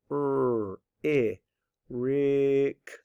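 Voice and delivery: R held as errr, short I, then RICK stretched; sounding out. Shown as a demonstration. Teacher sounding out second syllable, stretched sounds blended. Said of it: "you absolutely can sound it out".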